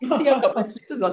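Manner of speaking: quick, high words through laughter; real laughing aside. Real, not acted. Teacher admits to slippers mid-exercise, laughing. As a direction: chuckle through the confession.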